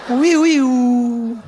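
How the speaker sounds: high wailing "wee-wee-oo"; wailing noise. Retold or imitated. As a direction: imitate a wail, high and drawn out. Imitating a noise in a story.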